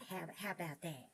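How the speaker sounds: ordinary talk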